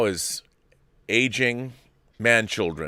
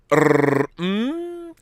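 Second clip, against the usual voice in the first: rolled trill climbing into a held high hum; =trill it, then let the hum rise; 9 semitones higher than usual. Shown as a demonstration. Repeats the noise as name.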